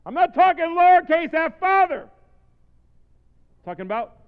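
loud, high-pitched preacher's emphasis; full-force preaching. Real, not acted. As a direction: build to a shout, then stretch the last phrase.

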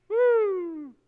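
high, loud "woo"; preacher's whoop. Real, not acted. Preacher after calling the hungry to eat, high whoop. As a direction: release a long high whoop.

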